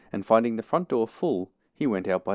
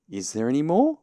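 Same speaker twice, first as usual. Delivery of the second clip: high, louder eager question; quick asking; 7 semitones higher, 6 dB louder than usual. A narrator's reading. Pooh asking if any food is left, quickly. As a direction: jump in with a rising question. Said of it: "asked Pooh quickly".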